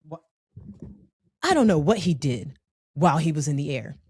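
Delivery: usual speaking voice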